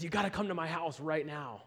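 retold urgent invitation; acted urgent insistence. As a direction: imitate an excited man insisting. Retold excited man insisting on visit.

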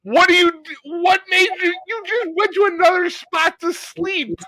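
very high, incredulous comic rant; hammed-up high-pitched disbelief; mock exasperation. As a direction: question the lazy cat in squeaky outrage. Mock scolding his yawning cat.

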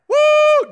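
high loud whoop of amazement; excited whoop. Retold or imitated. Disciples see the huge catch, excited whoop. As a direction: let out a joyful woo.

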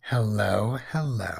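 ordinary talk

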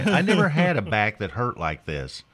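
ordinary delivery

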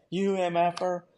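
high, heated mock shouting match; retold cursing. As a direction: imitate yelling orders back at an opponent. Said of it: "I just start cursing at him in English".

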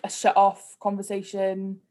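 ordinary talk, nothing marked

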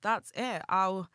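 clumsy attempt at a New York accent; slow, put-on New York accent. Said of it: "That was awful. I can't do a New York accent".

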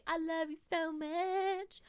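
slow, high, gushing; mock gushing, high and slow; casual gush. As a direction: drawl it out sweetly. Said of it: "you just say".

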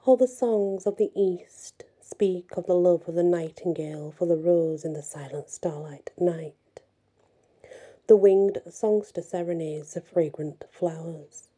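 gentle storyteller reading a fairy tale; story narration. A narrator's reading. Storyteller to listeners, reading Andersen's tale gently.

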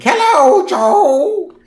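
high, loud creaky crone voice; very old woman. Retold or imitated. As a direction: croak it like an ancient granny. Old Grandma Flanders voiced.

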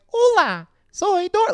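very high, bright mock girl voice; Dora voice. Imitating the woman skeleton at the party, very high. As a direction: squeak it out like a cartoon girl. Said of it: "homegirl started talking like".

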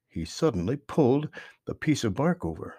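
usual speaking voice

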